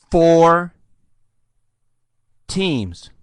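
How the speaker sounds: "Four" drawn out loud, long pause, then "teams"; very slowly. Real, not acted. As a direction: say it slowly for someone who won't understand. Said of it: "say it again very slowly".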